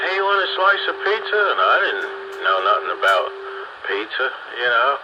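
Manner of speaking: ordinary talk, nothing marked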